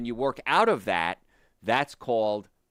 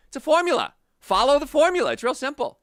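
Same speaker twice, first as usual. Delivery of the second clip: higher and louder, hammering the point; emphatic exhortation; 14 semitones higher, 9 dB louder than usual. Real, not acted. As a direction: drive it home like a rallying cry. Preaching health formula emphatically.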